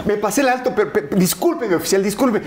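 voz aguda y suplicante de apuro; papá suplicante fingido. Retold or imitated. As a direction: finge estar desesperado ante el policía. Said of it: "mi papá se transforma". (papá finge urgencia ante el policía, voz aguda y suplicante)

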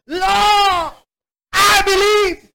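high, drawn-out desperate cry. Acted. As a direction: cry out to God in acted anguish. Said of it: "cried out and said with tears".

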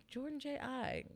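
high, soft, doubtful repeat; retold puzzled question; questioning echo. Comedian hearing the stage introduction, softly doubtful. Reacting to being introduced by name.

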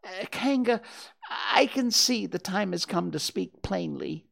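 high small voice, hesitant start; trying to sound brave. A narrator's reading. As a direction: muster courage for a formal declaration. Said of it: "in as brave a voice as he could".

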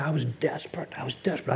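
soft, sinking repeat of a heavy admission; hushed confession. Real, not acted. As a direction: let it fall away quietly.

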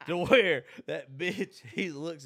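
laughing through the words, slowed down; cracking up mid-sentence. Real, not acted. Laughs while telling story.

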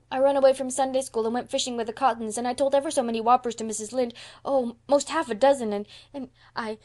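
put-on high, loud child's confession tumbling out; in a rush. A narrator's reading. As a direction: blurt it all out, then falter. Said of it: "Out it all came in a rush".

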